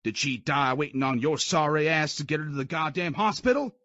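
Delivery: sneering shout; mocking drill sergeant. Sergeant mocks private.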